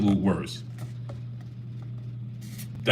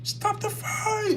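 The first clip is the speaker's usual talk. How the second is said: very high, drawn-out cry held back in volume; mock yelled game voice; 7 dB quieter, much slower than usual. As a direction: imitate a high, slow yell softly. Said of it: "somebody's yelling".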